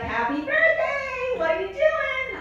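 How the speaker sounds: hammed-up cheery mother; cheery mom. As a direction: gush down the phone. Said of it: "she's like".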